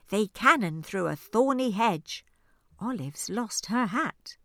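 usual speaking voice

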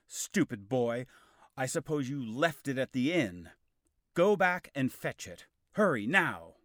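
loud, scolding orders; angry scolding. Acted. Kay scolding Arthur for the forgotten sword, loud and angry.

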